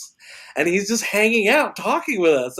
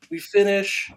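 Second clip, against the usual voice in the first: usual speaking voice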